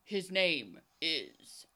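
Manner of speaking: lightly voiced solemn informant, slowing right down; slow dramatic reveal. Tongueless guy names the outlaw, slow mock-dramatic reveal.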